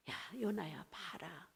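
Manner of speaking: low, hushed call; lightly voiced God, dropping very soft. God calling Jonah to look at Nineveh, hushed and low. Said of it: "그럴 때 하나님이".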